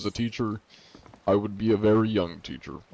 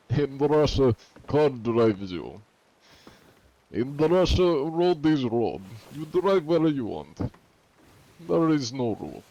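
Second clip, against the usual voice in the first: mock Russian accent, pitched up; put-on Russian accent; 4 semitones higher than usual. Streamer joking about driving in Russia, put-on Russian accent. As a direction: joke in a fake Russian accent. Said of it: "Get a Russian accent".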